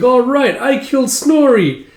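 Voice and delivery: high, loud, gleeful play yell; kid's triumphant shout. Retold or imitated. Child claiming a kill in pretend battle, triumphant shout. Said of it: "are all playing pretend".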